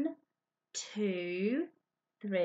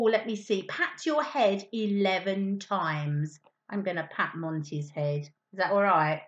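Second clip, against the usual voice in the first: ordinary talk, nothing marked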